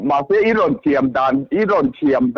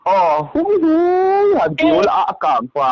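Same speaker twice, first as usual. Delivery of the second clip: high ringing call of hui hui; 12 semitones higher than usual. Acted. Calling to someone far away, high ringing call. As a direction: sing out the hui like calling across fields.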